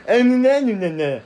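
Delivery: dopey cow noise. Acted. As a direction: blurt it out like a brainless calf.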